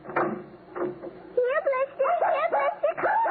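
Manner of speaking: high, rising calls for the puppy; calling the dog. Acted.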